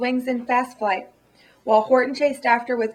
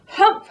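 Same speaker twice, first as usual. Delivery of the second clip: higher, louder snorted humph; scornful humph; 9 semitones higher, 7 dB louder than usual. Acted. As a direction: snort it out in disdain. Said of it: "humped a voice".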